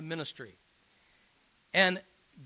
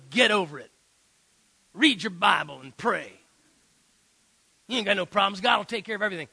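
brusque dismissal; 6 dB louder than usual. Retold or imitated. Retold dismissive counseling advice.

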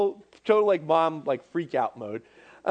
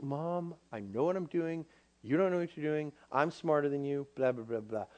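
soft, low grumbled backtalk; mock teenage know-it-all, tossed off softly; dismissive teen; 4 semitones lower, 6 dB quieter than usual. Teen self to mom while refusing to listen, dismissive.

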